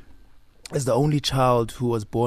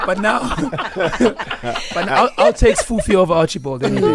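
laughing; 8 semitones higher, 6 dB louder than usual. Real, not acted. Laughing about nickname.

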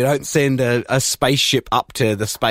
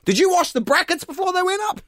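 mock mission control.

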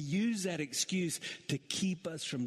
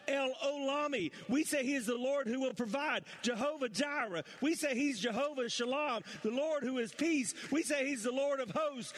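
litany rising steadily higher in pitch; building preaching; 8 semitones higher than usual. Acted. Pastor building to a climax for the church, rising preaching. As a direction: climb with every name of God, preaching harder.

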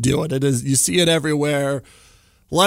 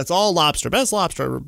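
ordinary delivery

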